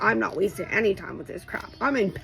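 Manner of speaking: ordinary talk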